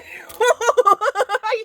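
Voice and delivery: laughing. Real, not acted. Laughing at picture.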